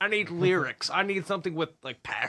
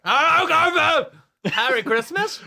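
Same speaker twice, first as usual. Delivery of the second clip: high, loud, slow strangled voice; drawn-out squeaky strangled reply; swallowed voice; 12 semitones higher, 7 dB louder, slower than usual. Pretend. Answer in the pigeon voice.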